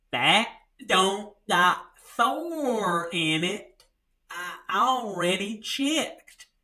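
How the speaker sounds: very slow, spelled-out mock small-town woman; talking slowly. Redbox woman repeats it slowly to him, very slow and deliberate. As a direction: drag each word out like explaining to a child. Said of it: "She had to talk slowly to me".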